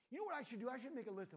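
a sudden hammed-up inspiration; mock eureka. Daughter mocked for overthinking, exaggerated eureka.

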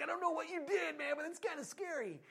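high, loud spooked neighbor. Retold or imitated. Neighbor telling Francis Chan it's scary, high and spooked. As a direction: sound rattled and a little scared.

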